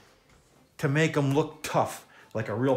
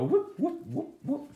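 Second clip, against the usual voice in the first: whooping sound effects; 13 semitones higher, slower than usual. Shown as a demonstration. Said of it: "you have to make the sound effects".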